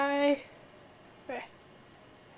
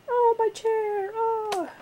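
dramatic cry; 10 semitones higher, slower than usual. Acted. Dramatic cry about chair.

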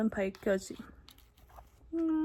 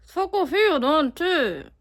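louder, higher sarcastic scolding; angry scolding; 5 semitones higher, 7 dB louder, slower than usual. Shown as a demonstration. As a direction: really lay into them. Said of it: "아, 다시, 다시".

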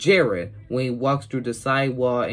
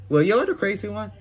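snarky comeback; 8 semitones higher, faster than usual. Retold or imitated. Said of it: "Then Joey said".

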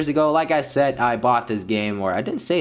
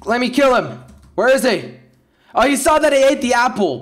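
eager hunt; 11 semitones higher, 5 dB louder than usual. Real, not acted. Chasing an opponent.

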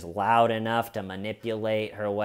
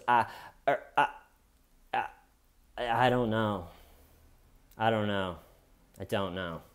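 imitated stumped hesitation; 4 dB quieter than usual. Suspect retold, stumped by questioning.

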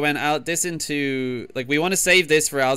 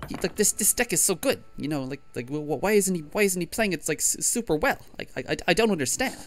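high, stammering streamer voice; Swim; 6 semitones higher, 4 dB quieter than usual. Acted. Player mimics a streamer baffled by his bad play, high stammering impression. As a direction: stumble over repeated words in a raised voice. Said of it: "that was my Swim impression".

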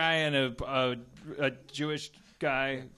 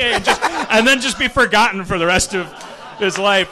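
laughing; 6 semitones higher, 12 dB louder than usual. Real, not acted. Laughing retelling.

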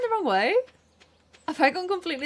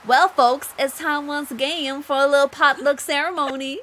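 louder, folksy Southern American mayor voice; 3 semitones higher, 4 dB louder than usual. Acted. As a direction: announce the potluck like a small-town Southern mayor. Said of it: "Everyone in mine's Southern".

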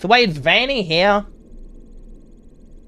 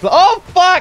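startled yelp; 5 dB louder than usual. Real, not acted. Startled by jump scare.

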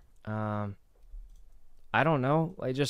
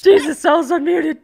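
laughing shout; 13 semitones higher, 13 dB louder than usual. Real, not acted. Realises he was unmuted again.

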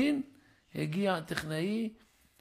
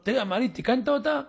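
louder and higher, demanding answer; pressing phone call; 6 semitones higher, 8 dB louder, faster than usual. Retold or imitated. Son calls the avrech pressing him about the repair, loud.

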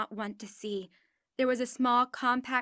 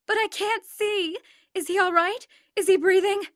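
frantic crying out; 8 semitones higher, 8 dB louder than usual. Retold or imitated. Injured mother crying out for her son, high frantic pleas. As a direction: beg for news, terrified. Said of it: "continued to cry out".

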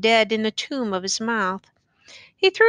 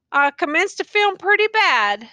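lightly voiced weary old soldier, musing; a hint of a rough country soldier, wondering aloud; tattered soldier; 7 semitones higher, 4 dB louder than usual. A narrator's reading. Tattered man's dialogue.

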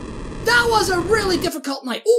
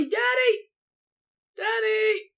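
calling for daddy; 6 semitones higher than usual. Acted. Voicing girl calling for father.

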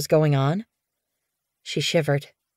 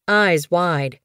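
usual speaking voice

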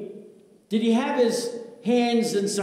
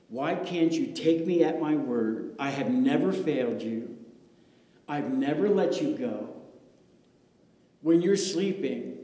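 Jesus speaking tenderly; 7 semitones lower than usual. Acted. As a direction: speak gently as Jesus to the pastor. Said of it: "Jesus is talking to me".

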